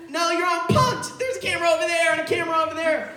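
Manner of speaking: high, fast mock-reveal voice; sarcastic excitement. Cops telling arrested friend he's on punked, mock-excited. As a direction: gush like a prank-show host.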